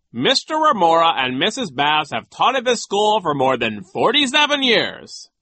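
high, loud, pompous brush-off; scornful high-voiced official; dismissive. A narrator's reading. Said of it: "Nero said dismissively".

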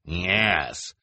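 a touch of spite; snarled. Acted. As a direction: snarl it with relish. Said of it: "Esme snarled".

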